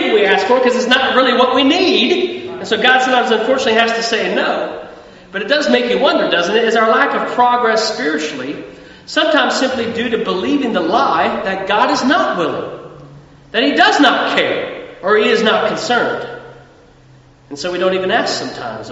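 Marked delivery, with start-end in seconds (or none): none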